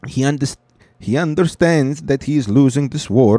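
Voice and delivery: mock Italian expert reading; lightly done Italian accent; bad Italian accent. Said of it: "I think that was not a good Italian".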